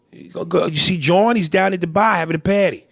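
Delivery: mock Bostonian with stretched A's; Boston accent. Shown as a demonstration. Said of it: "Bostonians, they stretch their A's".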